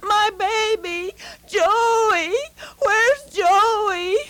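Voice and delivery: put-on panicked cry for a lost child; mother screaming for her baby in the fire. A narrator's reading. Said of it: "Mother was screaming".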